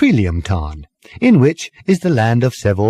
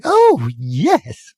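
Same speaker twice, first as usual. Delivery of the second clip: lightly voiced wizened old lady; a hint of cheerful piping; cheery little lady; 5 semitones higher than usual. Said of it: "she piped cheerily".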